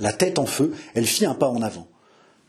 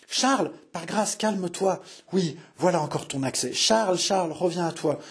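higher, softened, worried entreaty; anxious pleading; 5 semitones higher than usual. A narrator's reading. Marie calming Charles in his fit, anxious pleading. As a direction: coax him back, pleading.

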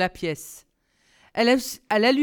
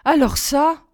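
raised, louder, mild astonishment; stammering; 5 semitones higher, 5 dB louder, slower than usual. A narrator's reading. Girl amazed by the prince's room, mildly stammering. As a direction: drag out the broken thought in disbelief. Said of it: "balbutia-t-elle".

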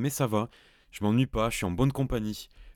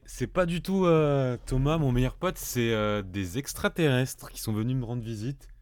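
ordinary talk

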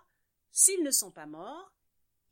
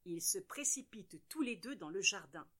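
ordinary delivery, nothing marked

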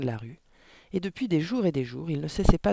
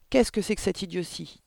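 irritated father; 4 semitones higher than usual. A narrator's reading. Father snatches the book, angry.

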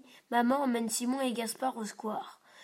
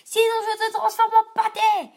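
much higher, louder threatening shout; furious threat; 10 semitones higher, 13 dB louder than usual. A narrator's reading. Simon threatens the big kid while pouncing on him. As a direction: charge in with a fierce yell.

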